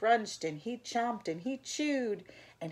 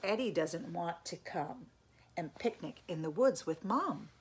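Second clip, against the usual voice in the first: ordinary delivery, nothing marked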